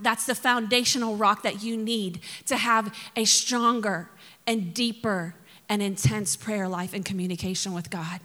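normal talking voice

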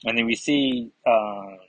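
usual speaking voice

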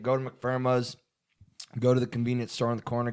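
ordinary talk

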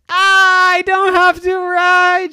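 high, loud, drawn-out cry of relief; joyful shout. Acted. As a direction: shout it out, thrilled to be let off.

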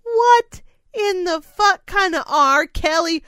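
recalling reaction to a song, incredulous; disbelieving. Retold or imitated. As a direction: spit out the disbelief. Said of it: "I start going".

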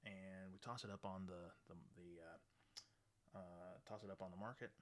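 ordinary talk

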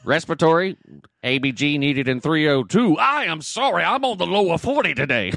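put-on page the floor like an old Southern farmhand; mock Southern accent. Nurse jokes about a hospital page in a broad Southern accent. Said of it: "I can do that accent a little bit too good".

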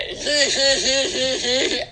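breathy wheezing cackle; hysterical laugh. Acted. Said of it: "why don't you do it again".

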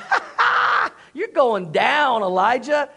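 gloating mock laugh into a drawn-out taunt; high cackling "ha, ha", then cocky trash talk; taunting prophets. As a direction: jeer at an outmatched rival. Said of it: "that they're like".

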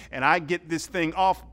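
usual speaking voice

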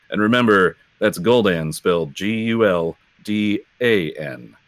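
announcer reading a show promo; radio promo read, slowing down for the spelling. Pretend. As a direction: put on a radio plug voice, measured and deliberate. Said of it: "let me put on my radio voice".

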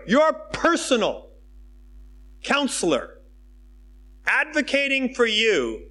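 normal talking voice

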